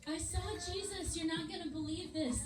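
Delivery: high-pitched eager announcement; acted excitement. Mary brings astonishing news to disciples.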